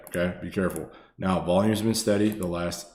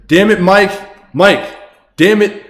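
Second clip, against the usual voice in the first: mock outrage; 11 semitones higher, 12 dB louder than usual. Real, not acted. Playful mock outrage at donor.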